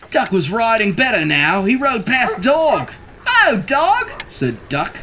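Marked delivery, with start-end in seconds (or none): 3.3-4.2 high-pitched greeting; Duck's cheery call. A narrator's reading. Duck greeting Dog from the bike, high cheery call.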